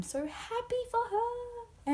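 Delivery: very high, slow, squealy delight; gushing squeal. Real, not acted. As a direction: melt with joy. Gushing fan.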